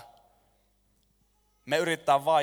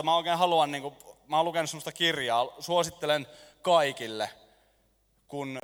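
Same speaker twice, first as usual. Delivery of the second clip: ordinary talk, nothing marked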